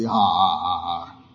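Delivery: low, soft mock laugh; deliberate spoken ha-ha, dying away; fake laugh. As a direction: laugh half-heartedly on purpose. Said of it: "if you like, say".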